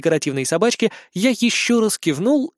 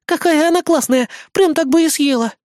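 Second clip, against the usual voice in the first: high, loud cuteness overload; gushing exclamation; 12 semitones higher, 4 dB louder than usual. A narrator's reading. Eris adoring the small idol, excited gushing. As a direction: gush in a squealing high voice. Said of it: "воскликнула Эрис".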